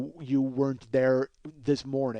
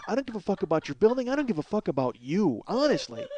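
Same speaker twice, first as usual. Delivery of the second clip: higher-pitched, rising on each item; exasperated rant; 8 semitones higher, 4 dB louder than usual. Acted. Building manager venting about complaints over a stolen bike, exasperated. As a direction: let loose a fed-up, indignant rant.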